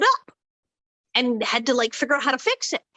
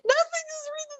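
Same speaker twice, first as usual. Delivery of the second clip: producers through laughter. Retold or imitated. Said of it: "And they said".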